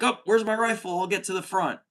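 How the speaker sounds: high eager soldier voice, shrugging off wounds; gung-ho soldier. Retold or imitated. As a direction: play a cheerful wounded grunt itching to fight. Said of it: "they're still being like".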